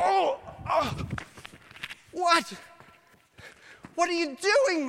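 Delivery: high, loud alarmed cry; startled protest. Retold or imitated. Leper protesting to guards who threw him down, alarmed.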